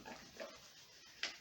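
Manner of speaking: whispered softly as the rubbing continues; fading whisper. Shown as a demonstration. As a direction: let the words fade into a whisper. Trailing off while rubbing.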